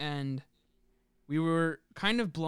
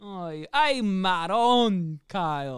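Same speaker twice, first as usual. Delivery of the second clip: slow, louder mock "Oy, ay marron" with a stretched "ay"; hammed-up Italian exclamation; 3 semitones higher, 6 dB louder, much slower than usual. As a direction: ham it up like a stage Italian uncle.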